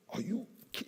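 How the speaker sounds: high, cut-off outraged start; a sudden high burst of outrage; retold cursing. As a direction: start to blow up, then break off. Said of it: "he's cursing".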